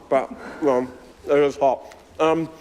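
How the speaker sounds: chewing while talking, mouth full. Real, not acted. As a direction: speak round a hot mouthful. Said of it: "sorry for talking with my mouth full".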